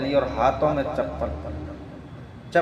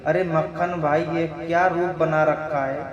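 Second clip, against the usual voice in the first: plain reading voice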